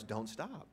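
Don't stop. said softly with a rising tune; an encouraging Don't stop; inviting don't stop. Retold or imitated. How the suitor read the note, a soft inviting Don't stop. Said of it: "forgot the first exclamation point".

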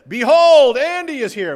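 hammed-up grand entrance call; mock herald announcement. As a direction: announce Andy like a royal herald. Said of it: "If I walked in and I said".

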